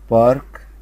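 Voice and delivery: called out loud for the class; loud model word. Shown as a demonstration. Teacher reads the column aloud to pupils, loud. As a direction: project it so the whole class can repeat. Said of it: "نقرا مع بعض العمود دوت".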